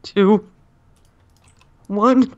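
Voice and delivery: rising countdown. Real, not acted. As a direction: build the countdown up. Counting down to a fight.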